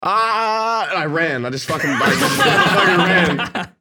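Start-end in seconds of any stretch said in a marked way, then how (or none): 0.0-0.9 high panicked "ah"; a scared yelp before running; startled yelp. Retold or imitated. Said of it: "And I'm like". 1.4-3.7 laughing through the words; slow, loud laughing disbelief. Real, not acted. Laughing retelling the escape.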